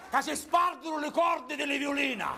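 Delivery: loud high farewell. Acted. Actor addresses audience, high.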